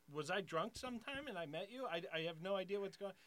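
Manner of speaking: high-pitched, flustered questioning. Retold or imitated. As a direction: act out being caught totally off guard.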